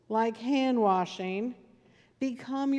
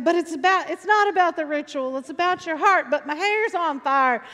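higher, louder driving preaching rhythm; riled-up preacher; 5 semitones higher, 6 dB louder than usual. Retold or imitated. Preacher mixing nonsense into his sermon, fired-up call. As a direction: imitate a preacher whipping up a frenzy. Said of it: "he'd start just spouting some nonsense".

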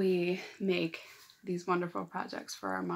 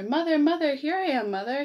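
higher, louder happy call to mother; excited calling out; 8 semitones higher, 7 dB louder than usual. Acted. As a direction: call out to mom with joy. Bird calls out to mother.

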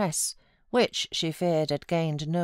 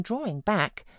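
ordinary delivery, nothing marked

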